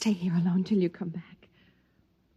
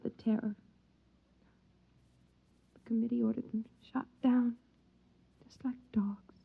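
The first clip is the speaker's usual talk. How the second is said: whispered with quiet horror; wife recalls the shootings to husband, whispering; horrified whisper; 7 dB quieter than usual. Acted.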